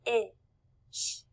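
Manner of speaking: "Fish" split into f, short i, sh with pauses; sounded out. Shown as a demonstration. Teacher blending with the class, sounded out. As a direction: say "Fish" sound by sound, slowly separated. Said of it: "let's blend it together".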